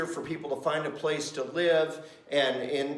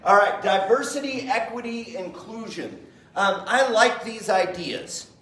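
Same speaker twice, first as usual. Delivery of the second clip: higher, brighter listing of ideas he loves; upbeat approval; 6 semitones higher, 6 dB louder than usual. Shown as a demonstration. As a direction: announce them cheerfully, like good news. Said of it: "I love these ideas in theory".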